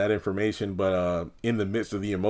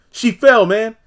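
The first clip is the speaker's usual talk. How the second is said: much higher, louder pleading excuse; high whiny excuse; 11 semitones higher, 7 dB louder, slower than usual. Retold or imitated. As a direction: blurt it out high and loud.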